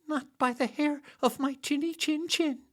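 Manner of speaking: very high, squeaky piglet voice; high little pig squeak. Acted. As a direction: squeak it out like a tiny frightened pig.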